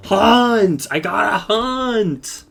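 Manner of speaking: hammed-up battle frenzy; frenzied cry. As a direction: howl it like a berserker waking. Brock snapping out of stupor.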